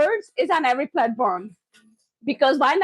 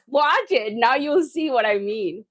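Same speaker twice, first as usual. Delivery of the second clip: ordinary talk, nothing marked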